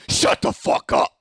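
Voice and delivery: slow, loud voiceless rasp; possessed-sounding harsh whisper, pushed hard; imitating menacing outburst. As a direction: rasp each word slowly and forcefully. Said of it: "this chick turns around to me".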